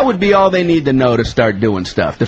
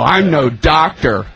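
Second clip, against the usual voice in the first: ordinary talk, nothing marked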